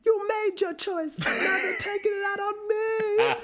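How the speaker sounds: very high, wailing, half-crying ghost voice; hammed-up Sheila impression. Podcaster hamming up the tortured grandmother ghost, high crying wail. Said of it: "if I'm gonna do an impression".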